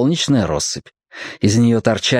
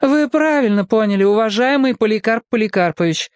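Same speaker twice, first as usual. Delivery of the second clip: exaggeratedly polite; 10 semitones higher than usual. A narrator's reading. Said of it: "со всевозможной вежливостью согласилась".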